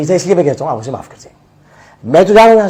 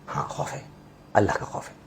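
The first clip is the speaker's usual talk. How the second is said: hushed; 6 semitones lower, 15 dB quieter than usual. Real, not acted. Admits only fear of Allah.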